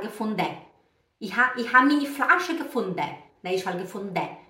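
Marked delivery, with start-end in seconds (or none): 0.0-0.6 Swiss-style gefunden ending in "-de", no N; Swiss German pronunciation. Retold or imitated. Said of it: "o suíço ele fala". 1.2-3.2 quick Swiss-style German, gefunden ending "-de"; Swiss German imitation. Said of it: "o suíço ele fala". 3.8-4.4 gefunden as Swiss "ge-fon-deh", no N; Swiss German pronunciation. Retold or imitated. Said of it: "eles nem falam N".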